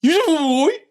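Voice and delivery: put-on screamed high in a Brummie accent; Brummie grindcore scream. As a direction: yell it like a Brummie metal singer. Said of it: "In a Brummie accent".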